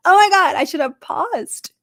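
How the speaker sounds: high, loud, mock-horrified gasp at her own replay; exasperated at herself. A speaker watching her YouTube replay, caught out, high loud dismay. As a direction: cry out in dismay at your own recording. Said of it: "I'm like".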